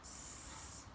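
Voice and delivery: a soft hissed S alone; soft hissed phoneme S; isolated sound. Shown as a demonstration. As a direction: hiss the S sound softly. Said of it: "The first sound I can hear is s".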